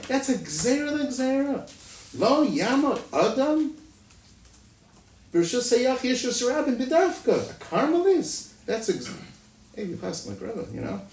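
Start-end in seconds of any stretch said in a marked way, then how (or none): 0.1-8.5 high, drawn-out imitation of screaming; mock scream. Teacher acting out the Rebbe's objection, high mock scream. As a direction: protest high and incredulous, stretching the key words. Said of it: "The Rebbe screams".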